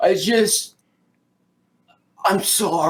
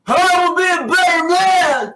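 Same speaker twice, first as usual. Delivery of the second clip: loud declaration, pitched up; shouting; 11 semitones higher, 3 dB louder than usual. Acted. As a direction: shout it out with a laugh. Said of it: "Haro shouted it out loud".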